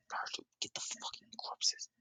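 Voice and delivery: hissed whisper; urgent whisper. Retold or imitated. Kiku telling Naruto to get the corpses, whispered.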